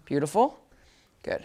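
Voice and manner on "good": ordinary delivery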